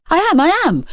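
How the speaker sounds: high, hurried reassurance; frantic backpedal. Acted. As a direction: scramble to take it back. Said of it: "frantically backpedaling".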